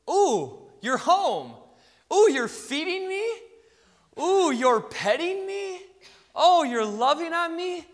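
high, loud, gushing dog voice; adoring dog. Acted. Dog marvelling at its owner's care, adoring. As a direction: coo each discovery with delighted wonder. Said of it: "so a dog, um, goes".

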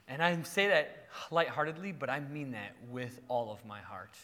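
ordinary talk

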